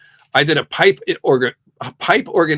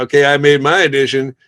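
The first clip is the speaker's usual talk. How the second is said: cocky; 7 dB louder than usual. Retold or imitated. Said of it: "everyone got all kind of cocky".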